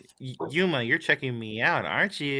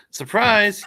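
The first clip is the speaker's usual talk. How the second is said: excited; 8 semitones higher, 7 dB louder than usual. A narrator's reading. Girlfriend surprises from behind, read aloud.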